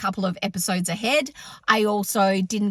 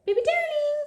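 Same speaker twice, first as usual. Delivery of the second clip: very high cooing sing-out; pet-calling voice. Real, not acted. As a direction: coo sweetly to a pet, way up high. Calling the cat to eat.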